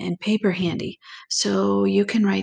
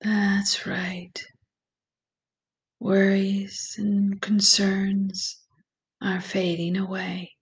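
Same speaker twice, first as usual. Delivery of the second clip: slow, calm meditation voice with long pauses; guided meditation; slower than usual. Acted. Guide leads listeners through breathing and visualization, slow meditation voice. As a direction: ease the listener gently into relaxation, unhurried and even.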